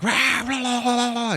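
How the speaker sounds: high, loud monster rah-ing; crazed roaring. Retold or imitated. A crazed man attacking her, roaring wildly. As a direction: roar like a crazed creature. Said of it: "who's like".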